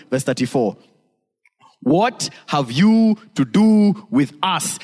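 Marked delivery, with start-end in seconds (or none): 1.8-4.8 slow, spaced-out, higher-pitched challenge; demons' challenge. Acted. Preacher quoting demons confronting Jesus, slow and emphatic challenge. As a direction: draw out each word in protest. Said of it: "Look at what they say".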